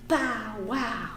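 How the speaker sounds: usual speaking voice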